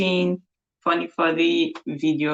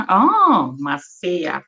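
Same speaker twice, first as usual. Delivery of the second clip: ordinary talk, nothing marked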